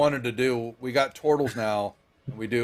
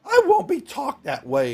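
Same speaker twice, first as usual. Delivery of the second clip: much higher, louder offended wife; indignant wife; 13 semitones higher, 3 dB louder than usual. Acted. Wife refusing her husband, high indignant voice. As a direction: bristle back at him. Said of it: "And she goes".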